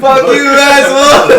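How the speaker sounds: high, full-voiced drawn-out yell; screaming. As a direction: yell the insult at the top of your voice. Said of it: "I run from this guy screaming".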